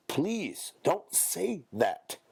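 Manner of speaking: high, slow begging please; pleading. Real, not acted. Begging listeners to stop the phrase, slow and high. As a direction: drag it out, begging them to stop. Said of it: "pleading with listeners".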